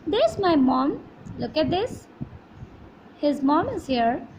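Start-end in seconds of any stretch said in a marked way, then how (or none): none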